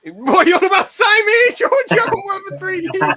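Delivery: very high, loud disbelieving squeal; incredulous squeal. Real, not acted. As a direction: shriek it in mock disbelief. Mocking a friend's false claim.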